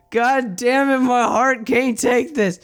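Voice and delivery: high, drawn-out heartfelt lament; overcome with emotion. Real, not acted. Player moved by the cat girls' reunion, overcome.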